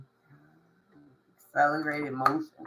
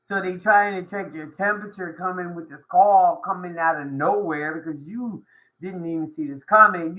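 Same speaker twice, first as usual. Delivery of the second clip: ordinary talk, nothing marked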